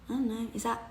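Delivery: ordinary talk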